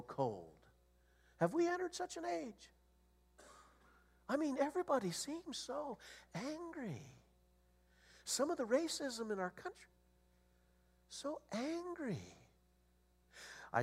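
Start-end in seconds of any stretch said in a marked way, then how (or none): 1.4-12.4 lament. Real, not acted. Lamenting an angry age.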